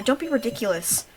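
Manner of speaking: ordinary talk, nothing marked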